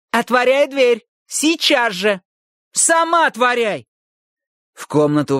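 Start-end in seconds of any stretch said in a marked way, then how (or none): 0.1-3.9 angry. Acted. Shouting match through the door.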